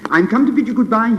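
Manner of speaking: high, loud, fast farewell; cry out. Acted. Swallow bidding the prince farewell, high and loud. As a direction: call it out quickly. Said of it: "he cried".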